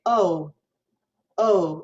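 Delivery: "Oh" gliding from O toward oo; American diphthong O. Shown as a demonstration. Teacher showing the normal American vowel, "Oh" as a diphthong. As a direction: let each "Oh" glide into a diphthong. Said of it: "normally when we use that O".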